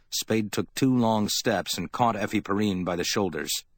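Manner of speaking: plain reading voice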